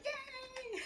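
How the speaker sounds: high-pitched, squealed little yay; gleeful cheer. Real, not acted. Host celebrating the end of Mercury retrograde, gleeful cheer.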